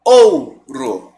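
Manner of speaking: Ouro with a strong first O and the U barely heard; stressed O. Shown as a demonstration. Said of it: "Qual que é o som que destaca mais?". (Ouro, com o primeiro O forte)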